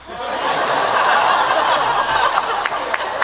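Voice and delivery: breathy high-pitched laugh. Real, not acted. Owner laughing at her talkative parrot, high and breathy.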